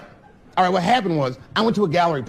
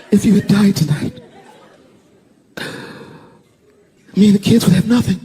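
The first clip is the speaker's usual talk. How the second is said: loud, higher mock-tearful wail; imitated crying; 6 semitones higher, 6 dB louder than usual. Crying wife to her husband after the attack, mock wail. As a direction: imitate a wife wailing through tears. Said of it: "she was just crying".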